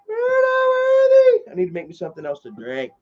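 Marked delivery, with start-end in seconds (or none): 0.1-1.5 much higher, slow squealed "We're not worthy"; Wayne's World squeal. Retold or imitated. Streamer bowing to a departing viewer, high slow squeal.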